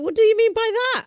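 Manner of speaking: high, fast, shocked retort; incredulous. Retold or imitated. Retelling how he answered his nephew, shocked and fast. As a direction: throw it back in disbelief. Indignant disbelief at nephew's remark.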